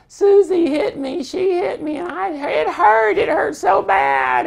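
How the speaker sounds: put-on child wailing to mom that her sister hit her; whiny child. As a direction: play a small kid milking a tiny hurt. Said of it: "But if it's something just like".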